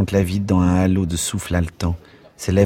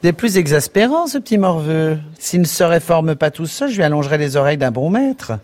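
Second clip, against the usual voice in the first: put-on much higher, louder, a prim irritated young woman; exasperated barmaid; 10 semitones higher, 3 dB louder than usual. A narrator's reading. Barmaid fuming at the clumsy boot boy, high exasperated voice. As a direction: scold the boy, frowning. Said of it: "Fronçant le front".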